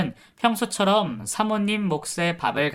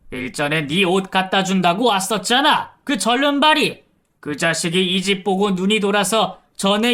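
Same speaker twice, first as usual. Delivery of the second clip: angry accusation; 3 semitones higher, 4 dB louder than usual. Retold or imitated. Nephew accusing the caregiver's husband, loud angry tirade. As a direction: tear into her and throw her out.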